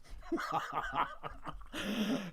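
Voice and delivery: soft, breathy chuckle; a quiet laugh to himself. Real, not acted. Host laughing at own joke.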